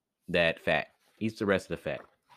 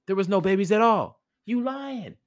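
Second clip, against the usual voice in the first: high-pitched indignant outburst; outraged accusation; 12 semitones higher, 4 dB louder than usual. Acted. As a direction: call out the liar, pitch shooting up. Mouse accuses cat of lying.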